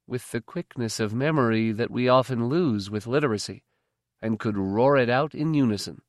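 plain reading voice

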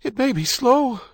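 high, strained cry; gasping. A narrator's reading. Wife in labour recalling nursing manuals, gasping. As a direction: push it out through labour pains. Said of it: "she gasped".

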